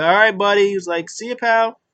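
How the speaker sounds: high, loud mock-friendly goodbye; imitated chirpy sign-off; breezy send-off. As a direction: toss off a chummy farewell. Acted breezy goodbye on leaving.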